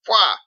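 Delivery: a loud, high scoffing blurt; mock scoffing pwah. Mason scoffing at Captain's refusal, loud mock pwah. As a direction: blow out a dismissive pwah.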